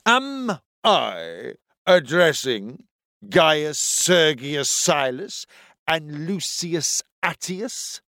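slow, pompous officer; deep authoritative voice. A narrator's reading. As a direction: lay on the authority, slowly. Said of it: "In a voice as deep and full of authority".

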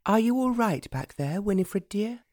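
high-pitched, lilting call back; calling over her shoulder. A narrator's reading. Young lady checking on her old nurse behind her, calling back. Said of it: "Lady Verner called over her shoulder".

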